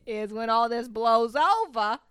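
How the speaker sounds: a light, mock New York accent; hint of a New Yorker griping; loosely done New York accent. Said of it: "Is that New York or New Jersey?".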